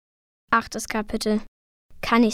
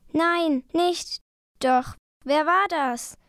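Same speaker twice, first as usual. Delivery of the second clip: higher-pitched mild protest; raised pitch, restrained alarm; urgent calling out; 5 semitones higher, slower than usual. A narrator's reading. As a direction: cry out in protest and confusion. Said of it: "rief er".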